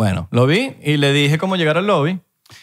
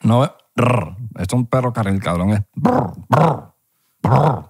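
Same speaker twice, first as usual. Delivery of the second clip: [name] as teacher; slower than usual. Pretend. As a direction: play [name] teaching the R, bored and slurred. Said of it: "clase de la pronunciación de la R".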